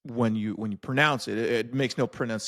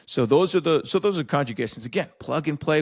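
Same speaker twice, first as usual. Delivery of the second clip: usual speaking voice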